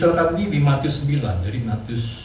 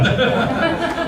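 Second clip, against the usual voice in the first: short laugh, much higher; 14 semitones higher, 4 dB louder than usual. Real, not acted. Pastor amazed God used him, high laugh. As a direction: laugh with delight.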